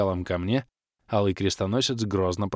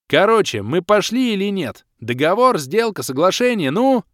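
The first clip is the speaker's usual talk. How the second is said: high, loud hurried demand; impatient loud pressing; 10 semitones higher, 8 dB louder than usual. A narrator's reading. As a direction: push him to decide right now. Герой торопит, срываясь. (громко и нетерпеливо, высоким голосом)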